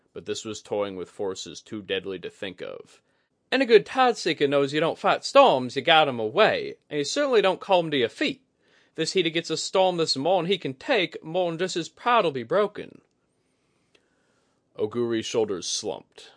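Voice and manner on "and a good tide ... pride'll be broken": high, bright-edged woman's voice, much higher; Kudaka voice. A narrator's reading.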